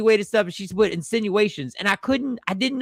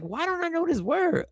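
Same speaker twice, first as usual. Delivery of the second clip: puzzled inner voice; 10 semitones higher, 5 dB quieter than usual. Retold or imitated. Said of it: "I go like".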